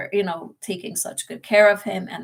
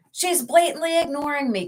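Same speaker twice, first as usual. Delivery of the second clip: higher-pitched indignant mimicry, not really yelling; mock outrage, pitched up at normal volume; imitated irate patient complaint; 7 semitones higher than usual. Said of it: "too busy yelling at the nurses".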